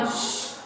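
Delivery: soft voiceless "shh"; acted mother's hush; hushing shush. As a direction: shush the child quietly. Mother hushes the child.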